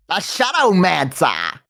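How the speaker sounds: slow, drawn-out villain announcement; necromancer voice. Acted. Streamer welcoming a new chatter, theatrical necromancer voice. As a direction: stretch the name out like a dark sorcerer. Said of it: "that's my Necromancer voice".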